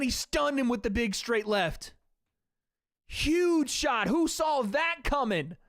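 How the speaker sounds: breathless live excitement after the big shot; hyped commentary. Real, not acted.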